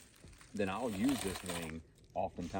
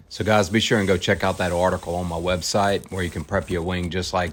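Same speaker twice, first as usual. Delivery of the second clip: loud; 13 dB louder than usual. Real, not acted. Loud closing over noise.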